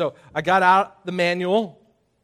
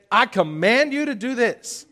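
bossy mock command voice, raised; preacher mocks giving orders to the congregation, raised and bossy; 7 semitones higher, 4 dB louder than usual. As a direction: play a pushy boss giving orders. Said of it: "If I was to stand up here and say".

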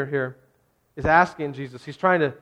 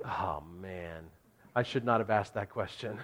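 much softer and lower, deflated; sheepish regret; 8 semitones lower, 12 dB quieter than usual. Retold or imitated. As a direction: mumble it like you just embarrassed yourself. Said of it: "I'm sure Peter's like".

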